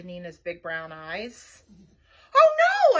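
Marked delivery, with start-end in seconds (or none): 2.3-3.0 high, loud shout of dismay; shouted alarm. A narrator's reading. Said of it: "I shouted".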